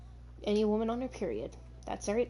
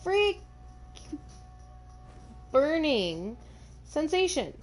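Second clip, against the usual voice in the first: mock dramatic exclamation; 7 semitones higher, slower than usual. Real, not acted.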